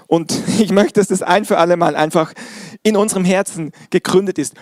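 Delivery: ordinary talk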